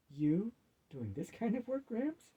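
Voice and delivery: slow, wondering question rising high on Grams; amazed grandson, drawn out and lifting at the end; slow awed disbelief, voice climbing. A narrator's reading. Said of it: "he said with wonder".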